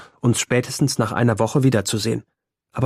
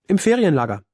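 offhand; 6 semitones higher than usual. A narrator's reading. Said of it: "Er sagt es ganz beiläufig".

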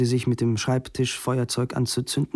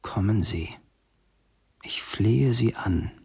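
slow, musing repetition of a plea; saying it to himself; 3 semitones lower than usual. A narrator's reading. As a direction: draw it out, talking to yourself. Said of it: "sagte er vor sich hin".

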